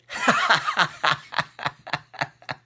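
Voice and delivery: real laugh bursting out mid-story; burst of laughter. Real, not acted. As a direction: let a loud laugh break out. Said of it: "I burst out laughing".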